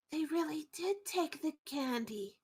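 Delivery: hushed but easily overheard; kid's failed whisper. Acted. Kid telling another about candy, badly whispered. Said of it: "whispering quietly. They think they're being quiet".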